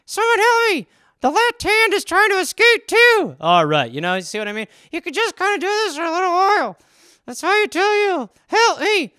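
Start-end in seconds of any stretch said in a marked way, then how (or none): 0.1-3.3 high squeaky puppet voice, pleading; hand puppet calling for help. Acted. Puppet hand begging someone to rescue it, high pleading squeak. 4.9-8.3 high squeaky puppet voice, cheeky; ventriloquist hand voice. Acted. Ventriloquist dummy teasing its owner, high squeaky voice.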